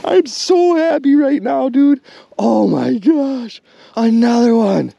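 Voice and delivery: high, loud joyful yelling; overjoyed. Real, not acted. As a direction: celebrate the catch. Lands second big perch.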